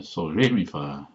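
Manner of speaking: sudden emphasis on re in sol, re, mi, fa; sharp accent on second syllable. Shown as a demonstration. Showing the word for singer, sharp stress on RE. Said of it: "then it's a sharp accent".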